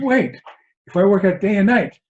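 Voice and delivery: high, loud mock protest; shocked student. Student bargaining with his Zen master, acted loud alarm. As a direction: cut in urgently as the eager student. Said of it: "A student is shocked by this".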